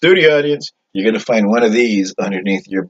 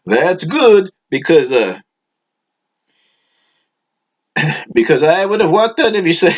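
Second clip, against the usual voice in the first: eager audience member; 8 semitones higher than usual. Acted. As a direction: pitch it way up like an eager fan in the crowd. Audience member replies to Rocco.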